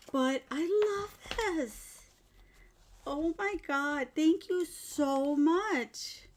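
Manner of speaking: high, slow, heartfelt gush; overjoyed gratitude. Real, not acted. Thanking a friend for the handmade gift, overjoyed. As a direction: lift the pitch and stretch the thanks.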